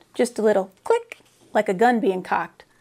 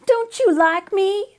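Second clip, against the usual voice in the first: higher, slow, on the verge of tears; a small girl starting to cry, lightly voiced; tearful little girl; 8 semitones higher, slower than usual. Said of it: "she didn't start to cry".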